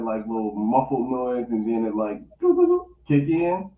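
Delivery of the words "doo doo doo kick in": high sung doo tones; imitated beep tones. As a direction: mimic a phone beep.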